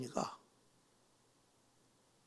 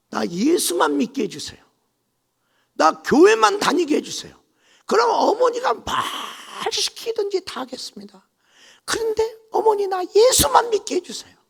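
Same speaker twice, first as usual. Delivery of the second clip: much higher, louder crying plea; desperate pleading; 10 dB louder than usual. Retold or imitated. Bride begging her mother-in-law to let her believe, desperately. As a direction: beg with all your heart.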